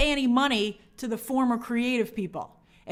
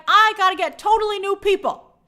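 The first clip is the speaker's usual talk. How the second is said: emphatic declaration; 7 semitones higher, 6 dB louder than usual. Retold or imitated. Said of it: "I'd be like".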